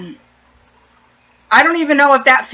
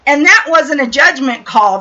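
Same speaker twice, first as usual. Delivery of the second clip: ordinary talk, nothing marked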